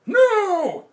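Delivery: put-on very high, loud angry old man no; angry shout. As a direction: bark it like a furious grandpa. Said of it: "shouted Grandpa angrily".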